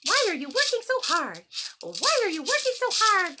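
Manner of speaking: high, loud carefree grasshopper voice; playful grasshopper. Acted. Grasshopper taunting the busy ants, high and loud. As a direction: tease the hard workers from up high. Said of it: "He said".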